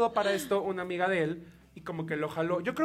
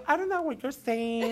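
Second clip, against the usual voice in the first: slow, higher English, a foreigner's voice; fake American; 5 semitones higher, slower than usual. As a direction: play the clueless gringo slowly. Faking he speaks no Spanish. (fingiendo ser gringo, despacio)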